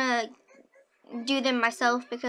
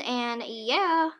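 ordinary delivery

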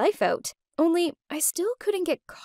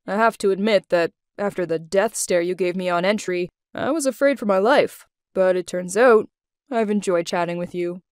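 former boss in a lowered voice; Adrian; 6 semitones lower than usual. A narrator's reading. Ex-boss admitting he enjoyed their chat, low male character voice. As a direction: drop the pitch for the man's admission.

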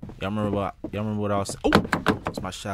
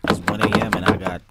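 ordinary talk, nothing marked

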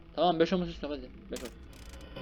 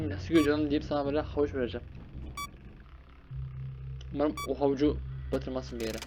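ordinary delivery

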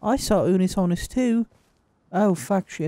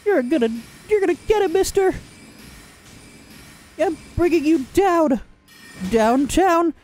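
high, fast, excited threat; pitched-up hammed-up war cry; squeaky battle cry; 9 semitones higher than usual. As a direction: shriek it like a cartoon hero charging in.

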